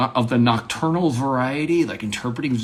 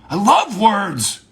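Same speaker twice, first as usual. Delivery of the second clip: high, loud, drawn-out outburst; mock big talk; 8 semitones higher, 7 dB louder, slower than usual. Declaring love of words, loud and impassioned. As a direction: cry it out with mock passion. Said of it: "have small talk as if it's big talk".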